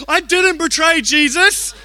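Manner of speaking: slow, loud, high-pitched mock wail; indignant outcry. Son protesting to dad before all the kids, indignant outcry. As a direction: draw out an imitated child's outraged denial. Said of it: "cries out in indignation".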